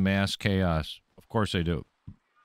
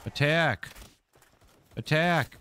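high-pitched call to attack; calling out commands; 7 semitones higher, slower than usual. Real, not acted. Player ordering his pal to attack, calling out.

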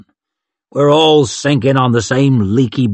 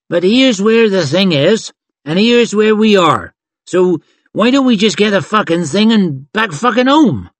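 high, impatient sweary push; exasperated urging; 8 semitones higher than usual. Acted. Robin urges the crew to finish and go home, exasperated. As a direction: press the point, pitched well up.

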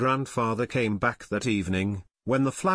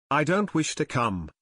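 ordinary delivery, nothing marked

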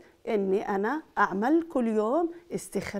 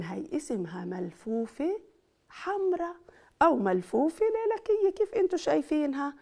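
put-on lively, louder teacher voice to kids; kindergarten teacher; 5 dB louder than usual. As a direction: coax the little ones, bright and loud. Teacher addressing young children.

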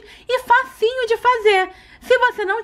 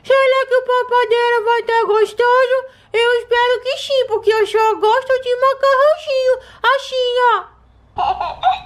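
high, squeaky little doll voice; 9 semitones higher than usual. Pretend. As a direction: play the eager little taste-tester doll. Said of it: "vou apresentar pra vocês a minha cobaia".

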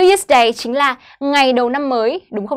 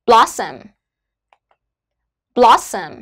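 "Blossom" with BLO- punched, -ssom dropping away; stress on first syllable; slower than usual. Shown as a demonstration. Teacher shows where word stress falls, BLO- stressed in "Blossom". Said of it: "Trọng âm của chúng ta rơi vào âm đầu tiên là blot".

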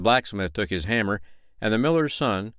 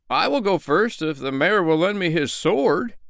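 higher, louder offer; bold volunteer; 8 semitones higher, 3 dB louder than usual. Acted. Shoemaker volunteers before the crowd, bold. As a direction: step up bravely. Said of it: "said the shoemaker".

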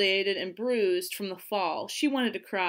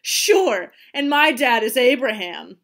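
loud sarcastic jeer; mock her with false agreement; taunting schoolyard sarcasm; 3 semitones higher, 11 dB louder than usual. A narrator's reading. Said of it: "they mocked her".

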